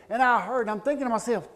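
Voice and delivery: usual speaking voice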